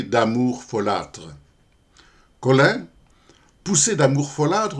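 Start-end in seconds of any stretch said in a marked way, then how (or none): 2.4-4.8 higher, livelier verse reading, lifted at the line end; poem recitation. Acted. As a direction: open the poem with a rising lilt. Commence la récitation du poème. (récitation de poème, ton plus haut et enlevé)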